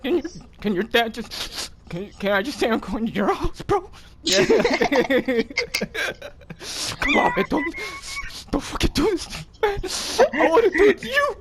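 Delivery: very high, laughing wail; whiny begging. Retold or imitated. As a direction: beg shamelessly in a high whine.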